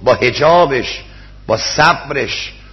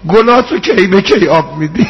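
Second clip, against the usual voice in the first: raised, tearful rowze recital to the congregation; mourning sermon; 5 semitones higher than usual. Acted. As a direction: preach the tragedy at full force, voice straining. Rowze mourning recital at full force.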